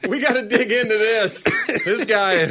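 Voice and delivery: high, laughing eagerness; hammed-up thrill at a juicy case; mock excited therapist. Therapist marvelling over a patient, mock excited.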